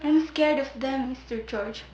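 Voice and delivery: a scared voice shrinking away; frightened hush. Acted. Sabina tells George her fear, soft frightened murmur.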